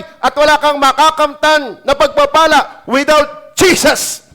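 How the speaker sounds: full-force preaching. Real, not acted. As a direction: preach it with fire to the church.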